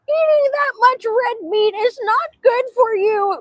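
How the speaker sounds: high, drawn-out mocking voice; mocking naysayer. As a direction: whine it slowly like a scolding critic. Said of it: "They're like".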